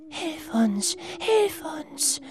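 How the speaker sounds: high, airy ghost whisper; eerie whispering washerwomen; ghostly whisper. Acted. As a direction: plead like a spirit from the mist. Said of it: "wisperten diese sonderbaren Wäschefrauen".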